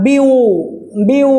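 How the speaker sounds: बीयू sounded out alone with a held "oo"; isolated syllable demo. Shown as a demonstration. Teacher showing a vowel sound, बीयू held out. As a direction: hold the final vowel of बीयू. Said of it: "यहाँ पर vowel sound बन रहा है".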